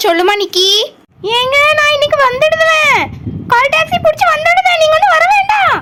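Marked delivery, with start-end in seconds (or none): 1.4-5.7 high-pitched wifely voice; wife on phone. Acted. Wife telling husband she is coming today, high voice. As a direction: pitch it up, rising as it goes.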